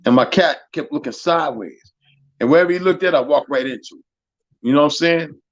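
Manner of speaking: ordinary delivery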